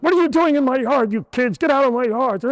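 put-on grumpy old man yelling. Grumpy old neighbour chasing kids from his yard, mock angry yell. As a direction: bark them off his lawn like a cranky old neighbour.

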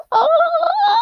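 very high, loud sustained coo; hammed-up high note of wonder; mock delighted "oh". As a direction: squeal one long "oh" in put-on awe. Acts Harry's reaction to the cake.

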